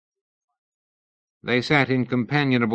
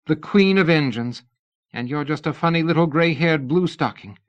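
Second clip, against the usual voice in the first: a higher, mildly mocking Tournachon; a sly little jab; 7 semitones higher, 4 dB louder than usual. A narrator's reading. Missus Tournachon teasing Lady Ada, mocking.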